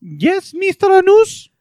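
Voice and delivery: high, loud put-on voice rising to a question; sister-in-law impression. Sister-in-law answers the Nepalese intern, high loud caricature. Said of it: "having a conversation with my sister-in-law".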